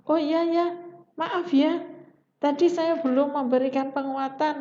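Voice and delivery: suddenly remember you forgot the praise; a mock flustered teacher; belated apology. Shown as a demonstration. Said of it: "tiba-tiba".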